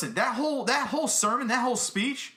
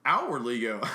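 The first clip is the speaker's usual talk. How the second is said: ordinary talk, nothing marked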